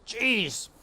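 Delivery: a fed-up guard mouse; high exasperated mouse voice; exasperated hooded mouse. A narrator's reading.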